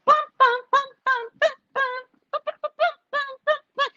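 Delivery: high, choppy sung piano notes; staccato mock piano; imitated piano playing. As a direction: plink out notes with the voice. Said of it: "she's playing the piano".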